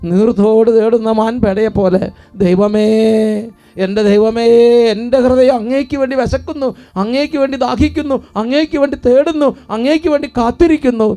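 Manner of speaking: fervent psalm prayer. Acted.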